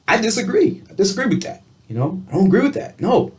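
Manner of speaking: lightly performed high-pitched disagreement; showing mad. Shown as a demonstration. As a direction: push it up high, not backing down. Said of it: "I'm mad".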